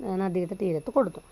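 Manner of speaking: ordinary talk, nothing marked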